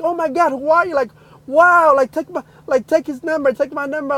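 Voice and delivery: high, excited gushing; retold excited mom; amazed mother. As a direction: gush at a stranger in disbelief. Retold amazed grateful mother on phone.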